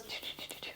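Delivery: soft whispered playful choo noises. Retold or imitated. Dad nuzzling baby son, whispered playful choos. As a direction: breathe the choos like a game with a baby. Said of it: "And he just goes".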